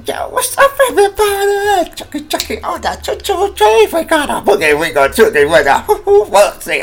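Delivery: very high, loud, laughing hype voice; hyped-up radio host. Pretend. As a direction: hype the crowd like a party DJ. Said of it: "get on something like this and just like".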